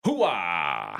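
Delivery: gung-ho soldier grunt, lightly done; Al Pacino hooah. Retold or imitated. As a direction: bark it like a proud army colonel. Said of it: "That's an Al Pacino thing".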